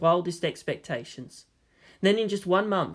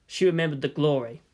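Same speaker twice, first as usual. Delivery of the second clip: plain reading voice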